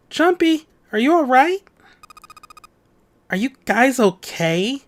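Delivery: high, worried voice calling out; anxious calling. Acted. Friends calling through a door, anxious and high. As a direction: call out anxiously to check on them. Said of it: "could hear anxious voices muffled".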